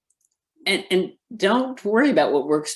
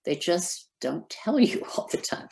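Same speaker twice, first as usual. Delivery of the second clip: whispered aside; 3 semitones higher, 4 dB quieter than usual. Real, not acted. Wry aside about hospitals.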